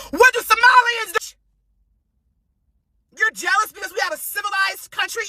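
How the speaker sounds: raging yell at the critics; angry shout. Real, not acted. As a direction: shout it out in real anger. Furious rant, shouting at critics.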